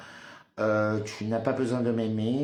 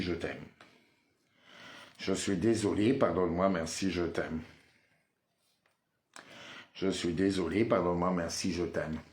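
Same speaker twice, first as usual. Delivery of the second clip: repeated mantra; 3 semitones lower than usual. Acted. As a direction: murmur it like a prayer.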